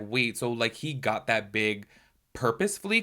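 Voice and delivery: normal talking voice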